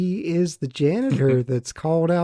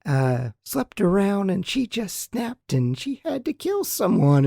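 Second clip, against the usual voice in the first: mimicking a ditzy cheerleader, pitched up; sing-song teenage girl; 5 semitones higher than usual. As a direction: gossip in an annoying higher-pitched sing-song. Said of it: "teenage girl kind of sing-song mimic".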